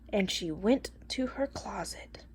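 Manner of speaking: hushed suspense whisper. A narrator's reading. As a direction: whisper it like a secret. Suspense before disguise.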